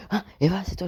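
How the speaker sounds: mock-thrilled cry, pitch leaping up; retold excited recognition. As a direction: imitate your old overexcited reaction. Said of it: "Je, je disais".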